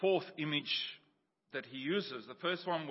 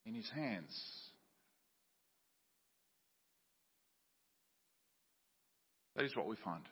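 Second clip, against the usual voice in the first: soft assurance; 3 semitones lower, 9 dB quieter than usual. Real, not acted. Preacher softening on assurance.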